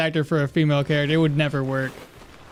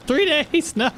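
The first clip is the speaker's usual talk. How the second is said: falsetto NPC line; 10 semitones higher than usual. Acted. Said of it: "I need more".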